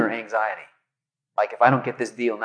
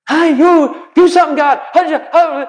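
frantic Moses; 13 semitones higher, 7 dB louder than usual. Retold or imitated. Moses panicking before Red Sea.

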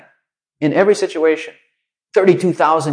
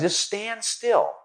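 Just stand still, slow and soft; God soothing Moses, unhurried; drawn-out, hushed calm; 7 semitones higher, 7 dB quieter, much slower than usual. Retold or imitated. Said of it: "and God says".

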